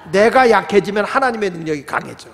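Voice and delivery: normal talking voice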